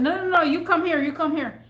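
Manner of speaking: high, loud, quick command; retold bossy summons; urgent order. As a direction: call the customer back sharply. Ordering customer back.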